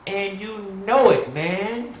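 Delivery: high, loud stoner voice; a high, stoned Smokey drawl; Smokey impression. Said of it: "Like Smokey on Friday".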